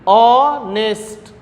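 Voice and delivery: ऑनेस्ट drawn out very slowly, vowel first, no h; ऑनेस्ट stretched out, opening on the vowel; slow demonstration. Shown as a demonstration. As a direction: spell out ऑनेस्ट slowly for the class. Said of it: "ऐसे देखिएगा".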